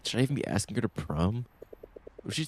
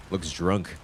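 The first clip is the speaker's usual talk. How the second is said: ordinary talk, nothing marked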